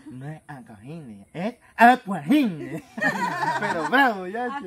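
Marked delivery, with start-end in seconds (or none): 0.1-1.2 slow, careful mock correction; mock gangoso. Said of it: "le dice". 1.3-2.8 slow and louder insistence; mock gangoso insisting. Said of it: "le dice".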